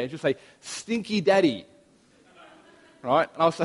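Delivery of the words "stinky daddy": a lightly put-on toddler voice, higher and slow; little daughter's voice. Three-year-old telling her dad the joke's punchline, slow and higher.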